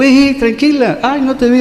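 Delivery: acted startled apology. Retells startled apology to his sister.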